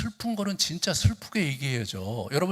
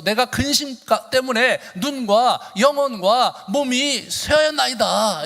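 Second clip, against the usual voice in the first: higher and louder, slowing into a wailing plea; impassioned lament; 7 semitones higher, 4 dB louder than usual. Shown as a demonstration. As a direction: pour out grief to God, crying out. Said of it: "이렇게 하는 거잖아요". (여호와여를 부르짖는 탄식 기도)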